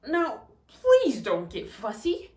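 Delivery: slow, deliberate fatherly warning; stern dad. Shown as a demonstration. Reading the dad's line to students, slow fatherly warning. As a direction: settle the fussy child slowly. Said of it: "said her daddy".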